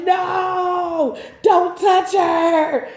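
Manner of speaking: high, loud, slow sing-song shout; mock shout. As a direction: hold them off with a stretched-out, sung-out yell. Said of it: "they wanna be like".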